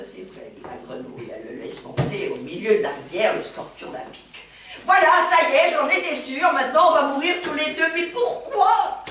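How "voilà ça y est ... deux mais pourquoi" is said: high, loud panicked frog cry. Retold or imitated. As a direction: wail it like a doomed frog. Frog panics, stung mid-river.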